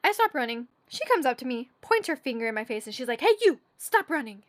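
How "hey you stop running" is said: high-pitched mock order; pitched-up retold reprimand; scolding security lady. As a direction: imitate a guard telling someone off.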